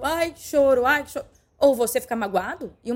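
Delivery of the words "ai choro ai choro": mock whining. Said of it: "tudo que falam com você vira choro".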